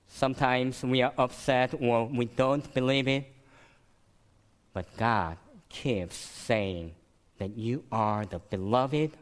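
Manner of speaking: ordinary talk, nothing marked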